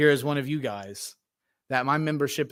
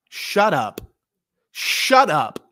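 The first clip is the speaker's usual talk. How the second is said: mock crowd snapping shut up; hushing shout; 3 semitones higher, 4 dB louder, much slower than usual. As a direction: hush him like an annoyed crowd. Crowd hushing blind man.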